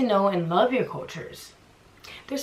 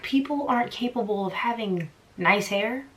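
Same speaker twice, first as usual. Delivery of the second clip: ordinary talk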